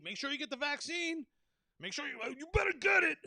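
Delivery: a mock scolding pusher; mock nag. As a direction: sneer it like a know-it-all. Mocking vaccine pushers.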